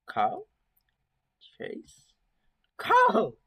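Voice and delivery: nervous calls building to a loud shout; calling out. Acted. Narrator calling for his friend, nervous then yelling. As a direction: call into the dark, then yell the name.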